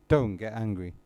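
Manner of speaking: low, soft repeated advice; hushed repetition. Acted. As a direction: drop right down and say it quietly. Teacher repeats advice, dropping voice.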